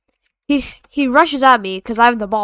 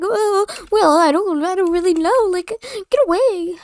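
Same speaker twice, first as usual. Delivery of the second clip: put-on higher, flustered kid fumbling words; mock the kid caught off guard; stuttering kid; 7 semitones higher than usual.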